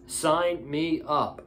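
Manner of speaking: slow, deliberate repeat; slow and drawn out. Real, not acted. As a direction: stretch each word out slowly. Repeats, savoring the idea.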